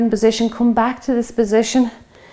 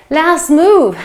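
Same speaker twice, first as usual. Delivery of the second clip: loud encouragement; 6 semitones higher, 7 dB louder than usual. Real, not acted. Coach pushing final exercise.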